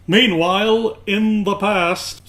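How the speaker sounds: big trailer-narrator "meanwhile"; dramatic announcer voice. Pretend. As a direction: boom it like a cartoon narrator. Dramatic scene-shift announcement.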